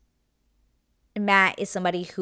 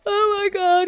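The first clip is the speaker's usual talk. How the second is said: hammed-up tearful wail, much higher and louder; mock crying; 8 dB louder than usual. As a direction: imitate her bursting into tears. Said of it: "starts crying".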